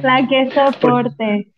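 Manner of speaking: slow, stretched mock drawl; a light parody of trendy girls; mocking girl talk. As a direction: join in the teasing imitation, dragging the words. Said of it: "porque hablan así".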